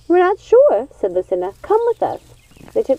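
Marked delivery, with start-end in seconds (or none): 0.1-0.9 high, uneasy witch voice; unsure and a little alarmed; worried witch. Acted. Worried odd sister. 1.6-2.2 high, coaxing witch voice; beckoning witch. Acted. Odd sister leads Gothel.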